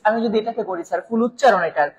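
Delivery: normal talking voice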